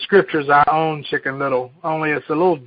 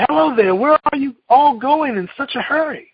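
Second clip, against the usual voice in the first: Goosey Lucy; 10 semitones higher, 3 dB louder than usual. Acted. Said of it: "Goosey Lucy said".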